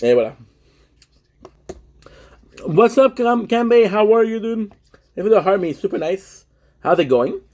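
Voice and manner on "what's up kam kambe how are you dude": high, lifted hello to a viewer; pitched-up friendly shout-out; excited greeting. Real, not acted. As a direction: greet the chat with a big upbeat lift. Greets chat member by name.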